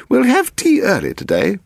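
put-on high, fussy elderly lady; pedantic old woman. A narrator's reading. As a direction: lay down the rule like a fussy dowager. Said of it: "she had said pedantically".

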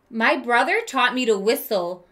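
gentle read-aloud storytelling voice; story narration. A narrator's reading. Teacher reading a picture book to kids, storytelling voice. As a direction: read it like a children's book to the class.

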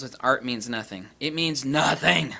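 ordinary talk, nothing marked

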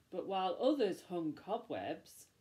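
usual speaking voice

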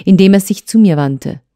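ordinary delivery, nothing marked

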